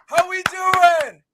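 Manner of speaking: loud, high, drawn-out gloat; triumphant taunting call; gloating shout. Retold or imitated. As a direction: yell it down the stairs to rub it in. Taunting the kid after win.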